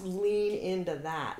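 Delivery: slow, drawn-out coaching tone; coaching advice. Retold or imitated. Teacher telling actors, slow. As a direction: stretch out the advice gently. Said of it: "I always tell my actors".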